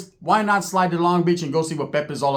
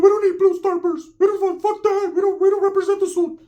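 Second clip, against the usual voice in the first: much higher, eager hype voice; high excited Ricky; 12 semitones higher than usual. Retold or imitated. Ricky jumping into the car, high excited yelp. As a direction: yelp it like an overexcited kid. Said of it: "Ricky's like".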